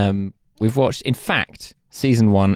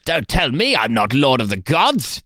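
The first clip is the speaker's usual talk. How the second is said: Zeus impression; 4 semitones higher than usual. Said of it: "That is not Matt Berry".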